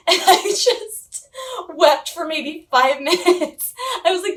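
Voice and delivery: giggling while telling how long she cried; laughing. Real, not acted. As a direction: laugh through the memory of weeping. Laughing while recounting crying.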